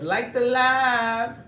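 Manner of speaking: lightly voiced high drawn-out imitation of a viewer; Trisha Nicole voice. Streamer mimics a viewer's comment in her voice. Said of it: "Trisha Nicole voice".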